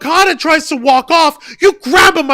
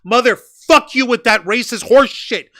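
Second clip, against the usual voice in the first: ordinary delivery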